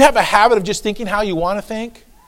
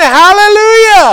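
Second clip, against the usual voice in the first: high, drawn-out praise shout; full-voiced hallelujah yell; 12 semitones higher, 10 dB louder, slower than usual. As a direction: belt it out, slow and very loud. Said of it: "Then we start yelling".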